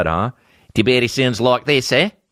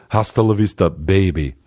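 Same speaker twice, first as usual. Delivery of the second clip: put-on low light Austrian accent; a hint of Arnold's growl; Austrian Terminator voice; 4 semitones lower than usual. Quoting the Terminator, light Austrian accent.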